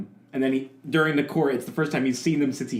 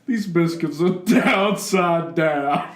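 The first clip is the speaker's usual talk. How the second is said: slow, laughing Southern drawl; Southern lawyer drawl; 3 semitones higher than usual. Pretend. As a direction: plead to the judge, drawling. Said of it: "anything that begins with Your Honor".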